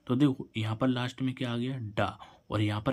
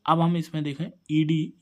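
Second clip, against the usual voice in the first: ordinary talk, nothing marked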